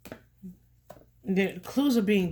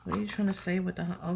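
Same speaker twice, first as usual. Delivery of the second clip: normal talking voice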